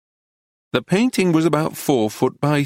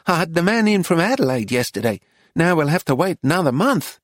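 higher-pitched scolding shopkeeper; put-out, higher character voice; cross shopkeeper; 5 semitones higher than usual. Acted. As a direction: complain as a peeved woman dealer. Voices Missus Lacy complaining.

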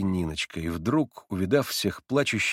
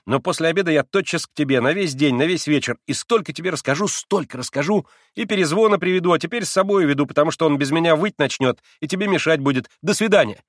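louder, higher, brisk; hurried boy; 7 semitones higher, 5 dB louder than usual. A narrator's reading. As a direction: cover emotion with chatter. (торопливо)

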